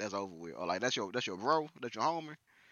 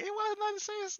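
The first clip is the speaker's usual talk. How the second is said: much higher, playful imitation of a girl; mock girl voice; 3 dB quieter than usual. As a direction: mimic her excuse in a high girly voice. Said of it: "she'd be like".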